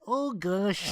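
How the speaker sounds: exaggerated Minnesota oh gosh; drawn-out Minnesota vowels; Minnesota accent. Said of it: "That's a Minnesota accent".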